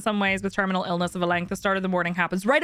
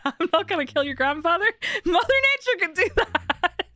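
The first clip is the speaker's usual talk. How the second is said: laughing quote; 9 semitones higher than usual. Retold or imitated. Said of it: "He said".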